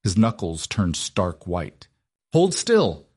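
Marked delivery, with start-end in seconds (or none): 2.3-3.1 commanding. A narrator's reading. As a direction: bark the order to stop him moving. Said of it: "she commanded".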